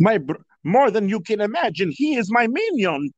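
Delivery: high, hammed-up movie villain voice; Gru. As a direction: ham it up as a cartoon villain. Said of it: "did the entire video in that voice".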